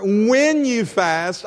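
"when" stretched out slowly; stress on WHEN. Real, not acted. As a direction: lean long on "when". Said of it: "highlight that word when".

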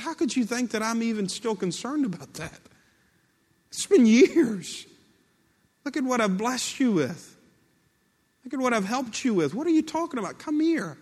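high-pitched, disbelieving protest; forgiving exasperation, voiced high; incredulous reassurance. Joseph's incredulous reply, acted.

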